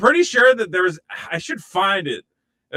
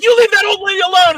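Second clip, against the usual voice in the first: much higher, louder hero shout; heroic yell; 10 dB louder, faster than usual. Retold or imitated. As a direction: bellow like an action star rescuing her. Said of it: "He's like".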